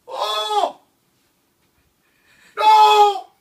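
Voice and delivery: high loud panicked cry; screaming while falling. Real, not acted. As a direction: shriek in fright. Virtual fall reaction.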